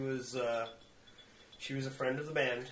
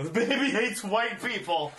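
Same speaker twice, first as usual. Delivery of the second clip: louder, raised call-out; retold yell; 9 semitones higher, 7 dB louder than usual. Narrator yelling a joke to his friend in the cinema, loud. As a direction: yell it over the film. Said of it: "and I yell".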